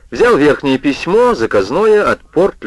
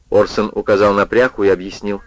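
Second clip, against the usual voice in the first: ordinary delivery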